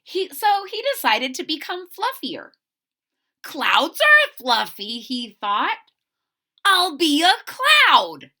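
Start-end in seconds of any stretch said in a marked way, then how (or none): 3.4-5.0 eager idea. Acted. Fluffy gets an idea. 6.6-8.3 high, loud, slow eager declaration; hopeful plan. Acted. Fluffy deciding to become a cloud, loud and eager.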